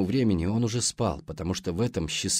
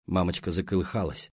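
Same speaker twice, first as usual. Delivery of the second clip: plain reading voice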